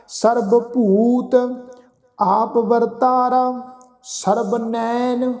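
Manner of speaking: slow, drawn-out sacred recitation; measured Gurbani reading, sustained line endings; scripture recitation. Shown as a demonstration. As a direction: chant each phrase slowly with long held vowels.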